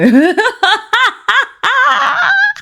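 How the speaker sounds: long, high cackling laugh; real laughing fit. Real, not acted. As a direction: burst out in disbelieving high laughter. Cracks up at the demand.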